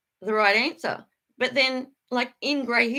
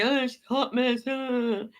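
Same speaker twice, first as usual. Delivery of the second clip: mock viewer comment. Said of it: "you guys are gonna be".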